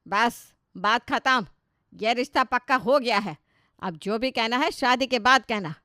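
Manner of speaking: a loud, unbending verdict from the mother; stern final word. Acted. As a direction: slam the matter shut at the top of your voice. Mother ends argument firmly.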